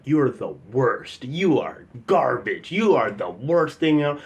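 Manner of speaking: slow, drawn-out mock heckling; imitated heckler laying it on; heckler insulting. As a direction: draw out each insult like a loud-mouthed heckler.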